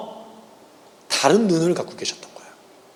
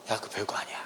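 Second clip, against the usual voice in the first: soft, unvoiced reassurance as Jesus; hushed, tender voicing of Jesus; gentle whisper; 10 semitones lower, 18 dB quieter than usual. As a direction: whisper it like comfort to a worried friend. Jesus voiced reassuring the listener.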